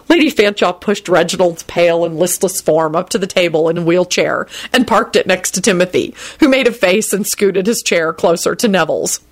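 ordinary delivery

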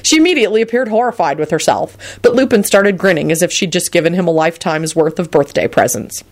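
ordinary delivery, nothing marked

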